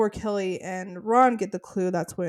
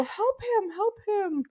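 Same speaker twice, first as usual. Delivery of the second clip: imitated yelling; 13 semitones higher, slower than usual. She's yelling at people, high imitated yell. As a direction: mimic her calling out for help, high-voiced. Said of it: "she's yelling at people".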